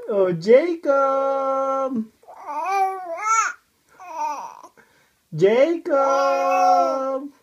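slow singsong call, name held long; playful drawn-out hail. Pretend. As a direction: call the name out, stretching the end. Calling the baby in singsong.

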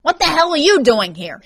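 high, loud alarmed demand; agitated, irate question; angry hiss. A narrator's reading. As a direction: confront the intruder urgently. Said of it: "he hissed".

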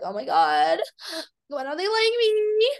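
hammed-up teary whine; self-mocking crybaby voice. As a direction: parody her own hurt feelings. Mocking her own hurt self.

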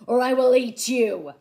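bellowing. A narrator's reading. Theodore threatening to eat the boy, loud bellow. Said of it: "he bellowed".

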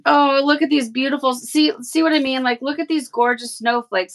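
gasping at pretty packaging, thrilled; excited gush. Real, not acted. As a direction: gush over the gift, delighted. Excited unboxing, gift revealed.

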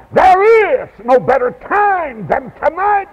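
high, impassioned preaching cry; urgent preaching. Real, not acted. Preacher urging the congregation to decide tonight, urgent preaching. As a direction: press the appeal with full force.

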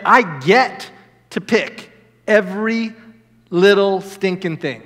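"get" stressed as a privilege. Acted. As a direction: GET punched, slow, spaced-out delivery. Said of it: "I say".

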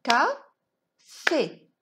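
"Café" clapped out as two loud, separate beats; syllable by syllable. Shown as a demonstration. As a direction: beat out "Ca", pause, "fé", firmly. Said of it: "On va frapper les syllabes".

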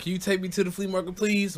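put-on high, fast kid voice pleading; begging little brother. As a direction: whine it like a little boy. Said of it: "So Randy was like".

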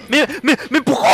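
high, loud stammered protest; startled outcry. Real, not acted. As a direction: stammer it out loudly in shock. Startled at being pushed in game. (sobresalto a los gritos, tartamudeando)

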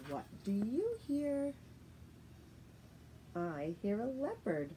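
ordinary talk

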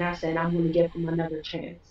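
very soft, low voice; small, low-toned confession; subdued recollection. Real, not acted. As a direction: quietly recall convincing yourself, low and hushed. Recalling her hopeful self-persuasion.

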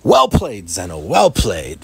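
loud triumphant cheer; victory shout. Real, not acted.

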